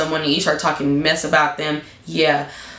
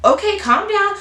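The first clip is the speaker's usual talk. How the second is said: much higher mock soothing; mock calming roommate; 12 semitones higher than usual. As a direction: imitate the roommate hushing you, pitched up. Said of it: "And then Penny was like".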